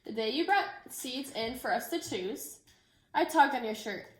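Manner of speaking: gentle, expressive picture-book read-aloud; reading to children. Acted. Teacher reading a book to her class, storytelling voice. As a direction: tell the story so the children follow every page.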